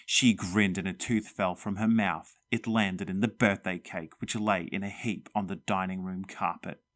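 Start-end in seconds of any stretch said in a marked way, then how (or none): none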